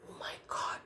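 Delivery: breathy unvoiced whisper; a hushed gasp of dismay. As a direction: whisper it to yourself in alarm. Dragon gasps to himself.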